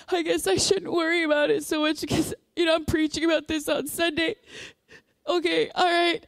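high, tearful mock-weepy voice; imitated crying. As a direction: act out conceding through tears. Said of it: "and then I'm crying".

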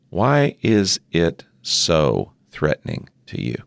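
ordinary delivery

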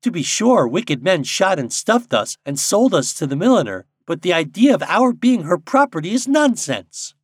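high, loud bird making a case; grave robin. A narrator's reading. Robin arguing with Popopo for freedom, gravely. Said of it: "said a robin redbreast gravely".

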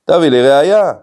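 loud, high demand for proof; mock shout. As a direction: yell it at the rabbi. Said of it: "ככה הוא צועק על רבי".

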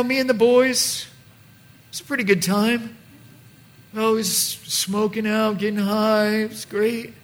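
lightly voiced dude fondly remembering the wild days; nostalgic party guy. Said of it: "you look back and you're like".

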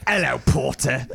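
hammed-up British film accent; mock British accent. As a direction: put on a Harry Potter-style British accent. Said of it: "our only British knowledge is Harry Potter".

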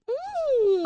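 high delighted hum; a squeaky happy mm; pleased mm. Retold or imitated. Sipping cute drink, reacting.

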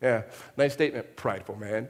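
low, lightly mocking aside; skeptical onlookers. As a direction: doubt the claim under your breath. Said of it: "people go".

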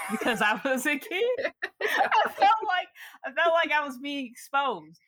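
high pitched laughter through the words; giggling it out, still embarrassed; laughing while talking. Real, not acted. Telling a podcast host about childhood embarrassment, laughing.

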